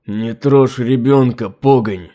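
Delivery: slow, rasping through blood; hoarse wheeze. Acted. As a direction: choke out the threat, wounded. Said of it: "хрипя кровавыми пузырями".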